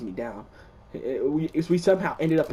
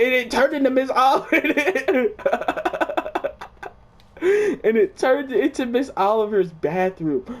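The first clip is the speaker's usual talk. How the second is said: laughing; 9 semitones higher, 4 dB louder than usual. Real, not acted. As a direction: giggle through the words. Laughing while retelling.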